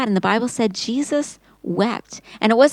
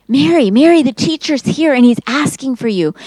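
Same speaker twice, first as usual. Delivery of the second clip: louder and higher, urgent call to her sister; excited sister calling Mary's name; 4 semitones higher, 6 dB louder than usual. Acted. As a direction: call out eagerly, news can't wait. Martha calls urgently to sister.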